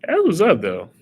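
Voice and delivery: high, loud burst of praise; a loud high that was up; excited exclamation. Real, not acted. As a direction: cry it out, impressed. Reacts to hard question.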